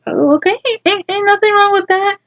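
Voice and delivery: high falsetto tease; mock innocence. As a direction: squeak it out in a mock-sweet tone. Mocking the guy's answer.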